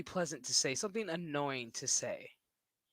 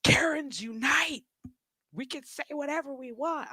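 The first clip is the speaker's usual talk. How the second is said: mock Karen rallying cry, higher and louder; 10 semitones higher, 5 dB louder than usual. Streamer mocking Karens to chat, mock Karen rallying cry. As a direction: ham up an entitled Karen. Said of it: "they're just like".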